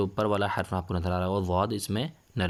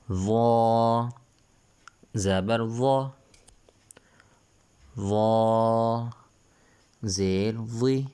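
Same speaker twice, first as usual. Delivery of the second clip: loud, drawn-out chant of each letter; Qaida recitation drill; 3 semitones higher, 3 dB louder, much slower than usual. Shown as a demonstration. Teacher chanting letters for pupils to repeat, loud and drawn out. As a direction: call out the letters so the class can repeat. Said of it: "وا کو نرمی کے ساتھ پڑھنا ہے".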